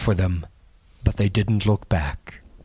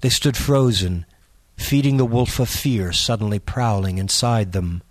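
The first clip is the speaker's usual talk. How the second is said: ordinary delivery, nothing marked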